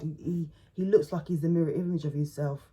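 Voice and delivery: ordinary talk, nothing marked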